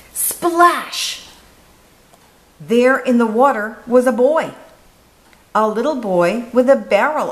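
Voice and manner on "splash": high, bright splash word; big splash. A narrator's reading. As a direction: make the splash leap up. Sound word in a story.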